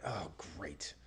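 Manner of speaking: low, hushed sarcastic aside; deflated. Retold or imitated. Driver told every bay is full, quiet deflated reaction. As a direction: drop to a soft, let-down mutter. Retold dismay at full bays.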